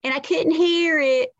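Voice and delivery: high, thick Southern twang; South Carolina accent. Retold or imitated. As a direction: put on a small-town South Carolina accent. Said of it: "it was Chesnee, South Carolina".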